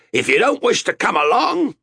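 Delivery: high, loud captain's shout. A narrator's reading. Captain heaving a sailor overboard, shouted. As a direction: yell it as a threat. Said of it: "he shouted".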